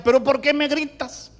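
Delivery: acting out a wife's complaint; complaining wife. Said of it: "cuando yo le hablaba, me decía".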